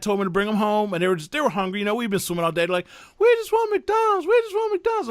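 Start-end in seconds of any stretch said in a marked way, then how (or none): 3.2-5.1 put-on high whining children begging; whiny kids. Hungry kids after swimming begging for McDonald's, high and whiny. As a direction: whine it like a tired hungry kid.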